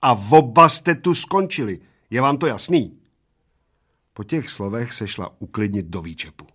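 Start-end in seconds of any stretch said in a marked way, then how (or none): 0.0-3.0 louder, stern threat; stern barmaid. A narrator's reading. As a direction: lay down the law. (přísná hrozba)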